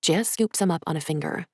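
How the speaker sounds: usual speaking voice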